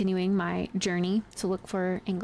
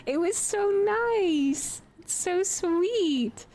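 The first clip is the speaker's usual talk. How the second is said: gushing; 10 semitones higher, slower than usual. Retold or imitated. Gushing over the streamer's effort.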